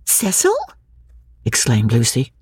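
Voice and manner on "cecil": high shocked cry of the name; a startled, higher squeal; a girl shrieking her fiancé's name in surprise; screech. A narrator's reading. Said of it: "Lucy, don't screech".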